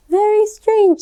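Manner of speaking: mock-bewildered mother; surprised mom. A narrator's reading. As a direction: sound baffled by her daughter. Mom in story.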